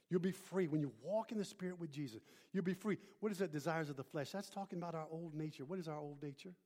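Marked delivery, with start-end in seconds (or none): none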